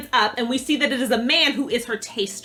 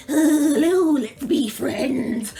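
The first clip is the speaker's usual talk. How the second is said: creepy apparat; 2 semitones higher, much slower than usual. Retold or imitated. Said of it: "He's like".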